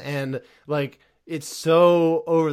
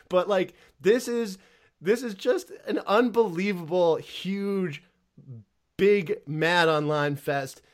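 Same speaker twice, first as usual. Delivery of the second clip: normal talking voice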